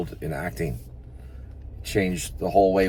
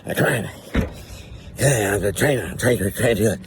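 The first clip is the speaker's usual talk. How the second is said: strained acting student; 3 semitones higher than usual. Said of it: "That's me walking in an acting class".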